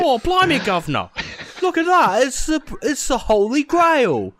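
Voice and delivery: put-on a deliberately awful British accent, laughing through it; shitty British accent. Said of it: "with a shitty British accent".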